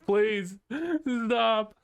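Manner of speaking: high-pitched begging, half laughing; mock pleading. Gamer recalls begging the game to stop, high mock plea. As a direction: beg in a high voice, pausing between words.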